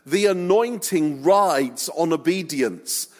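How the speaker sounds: impassioned preaching; preaching at full force. Acted. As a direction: press the point home to the congregation. Preaching climax of sermon.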